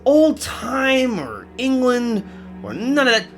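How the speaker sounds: high, drawn-out indignant character voice; high-pitched complaint. Acted. Questioning a demon's way of talking, high and indignant. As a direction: squawk the objection slowly.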